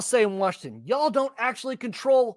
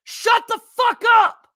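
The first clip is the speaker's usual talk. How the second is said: much higher, loud enraged yell; shouting down the TV; furious shout; 13 semitones higher, 8 dB louder than usual. Real, not acted. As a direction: scream back at the screen. Yelling back at news quote.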